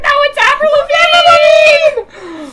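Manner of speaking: loud, high, slow laughing yell; laughing shout. Retold or imitated. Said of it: "and I was like".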